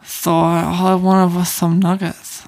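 slow, lisped mocking imitation; lisping friend. Asked to impersonate the co-host, slow lisp. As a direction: drag it out with a thick lisp. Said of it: "Do an impression of me".

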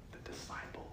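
hushed, voiceless whisper; very soft whispered reveal; whispered answer. Real, not acted. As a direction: whisper it like letting out a secret. Hushed answer to own question.

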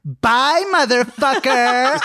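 high, loud, slow gloating bye breaking into laughter; mock taunting goodbye. Crystal ditching the guy, gleeful high laughing taunt. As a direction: cackle the goodbye and keep laughing. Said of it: "Crystal's like".

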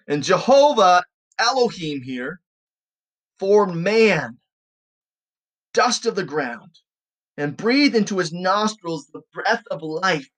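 slow, emphatic Bible reading; scripture reading. Acted. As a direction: read the verse out deliberately, weighting key words. Reads scripture aloud.

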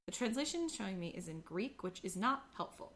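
ordinary delivery

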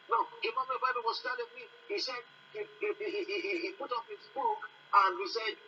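ordinary delivery

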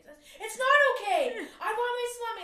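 high, loud hormonal outburst; wife melting down at Pete on the beach; freaking out. Retold or imitated. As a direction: throw a tantrum over salami. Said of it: "freaking out on Pete".

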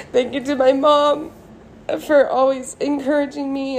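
choking up thanking mom; tearful laugh. Real, not acted. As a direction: thank her while the tears come.

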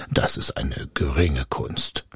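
deep, condescending spirit; haughty spirit. A narrator's reading. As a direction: dismiss it with lofty arrogance. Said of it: "Der Geist sprach voll Hochmut".

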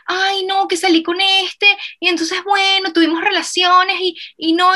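high whiny mock lament; mock whining friend. Mocking a friend who comes back whining about a date, high whiny voice. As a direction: whine it like a friend come back complaining. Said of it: "y luego vienen llorando".

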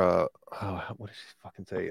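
normal talking voice

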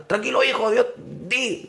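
high voiced father urging his son; father's voice. Father telling his paralyzed son to pray, high pitched.

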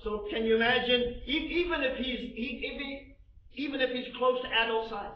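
high, loud, driving sermon delivery; preaching at full force. Acted. Preacher to congregation, full-force preaching. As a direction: drive it home to the congregation. Preacher builds loudly on Saul's armor.

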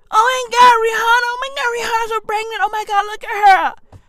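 high, rushed, hammed-up fan squeal; mock gushing fan. Fans gushing over pregnant [name], mock-excited. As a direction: imitate a starstruck fan in a high voice.

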